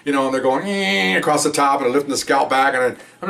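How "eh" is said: high, drawn-out cutting noise; imitated tool whine. Officer mimicking the autopsy cut, high whine. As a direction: mimic the cut across the scalp.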